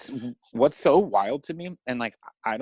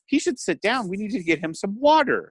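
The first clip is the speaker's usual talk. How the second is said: much higher, gently condescending care; mock soothing; 7 semitones higher, 3 dB louder than usual. Telling the ranting man to calm down, mock soothing. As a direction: talk him down like a fragile patient. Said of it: "by myself, I went".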